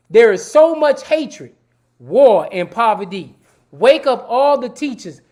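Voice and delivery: slow, loud, preached verse with long pauses; rousing recitation. Acted. Host reciting "Wake Up Everybody" to new listeners, preaching slow and loud. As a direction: declaim each line like a sermon. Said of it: "I'ma say it again for them".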